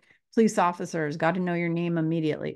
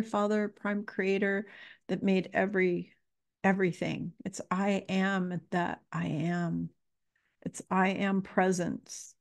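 ordinary talk

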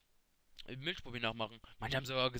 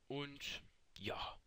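whispered aside; 9 dB quieter than usual. Acted. Gomme aside under his breath.

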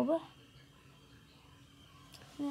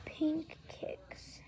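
whispered lower take; 7 dB quieter than usual. Shown as a demonstration. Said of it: "repeated take".

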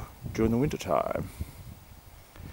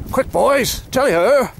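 excited shout; 14 semitones higher, 9 dB louder than usual. Acted. Host spots the animal.

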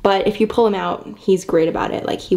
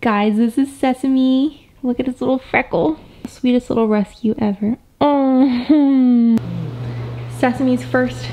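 sympathetic aww; 4 semitones higher, slower than usual. Real, not acted. Cooing over rescue kitten.

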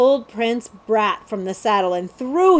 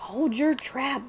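soft, slow, raised-pitch inner plea; silent thought; 4 semitones higher, 7 dB quieter, slower than usual. A narrator's reading. Jemmy silently wills the prince quiet, hushed thought. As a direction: urge it under your voice. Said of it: "Jemmy thought".

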